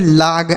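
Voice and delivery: लाग with a long "aa" vowel; wrong लाग. Shown as a demonstration. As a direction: demonstrate the misreading लाग, like "laag". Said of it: "ऐसा नहीं पढ़ना है".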